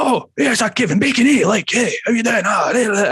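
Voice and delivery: high-pitched harsh Edinburgh impression; harsh Edinburgh accent. Band's drummer butting in on Kevin Bacon, harsh Edinburgh accent. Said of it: "really harsh Edinburgh hybrid weird accent".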